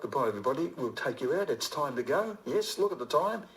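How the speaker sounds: ordinary delivery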